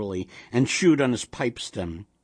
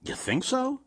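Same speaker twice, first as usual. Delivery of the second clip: put-on high, questioning old woman; lightly voiced old wife; doubtful old wife; 13 semitones higher than usual. A narrator's reading. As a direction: wonder aloud with pitch lifted. Old wife doubts husband's guess.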